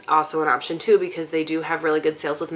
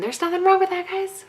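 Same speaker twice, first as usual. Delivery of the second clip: high-pitched reassurance; 13 semitones higher than usual. Real, not acted. Reassuring viewers about Googling.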